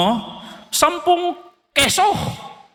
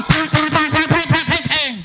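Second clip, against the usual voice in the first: rhythmic mouth gunfire bursts; imitated gunshots; 6 semitones higher than usual. Son on the phone, gunfire imitated in the background. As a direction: pop out each bang like shots.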